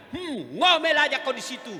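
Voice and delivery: husband taunting the nagging wife he pushed in; loud taunt. Retold or imitated. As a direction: yell it down the well at his wife. Husband yells down the well.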